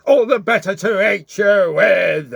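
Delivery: loud, high wolf roar. Acted. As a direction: roar it as you leap out. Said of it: "roared the wolf".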